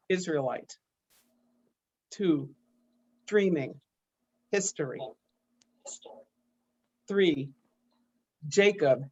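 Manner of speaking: slow, deliberate poetry recital with long pauses; poem reading. Acted. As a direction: set each numbered entry apart like a dictionary definition. Reads numbered-definition poem aloud.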